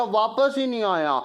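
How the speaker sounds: normal talking voice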